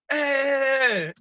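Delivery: long, high, loud "hey" holler; retold shout back. Driver getting involved with a yelling cyclist, re-enacted shout.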